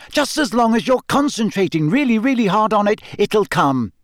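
high, insistent encouragement; firm Hermione. A narrator's reading. Hermione urging Harry to concentrate, firm. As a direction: press the point firmly, pitched high. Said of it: "said Hermione firmly".

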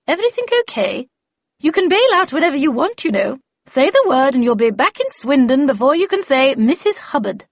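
high, emphatic character voice; lively, insistent veteran checking on a novice; Miss Havisham, offering a way out. Acted.

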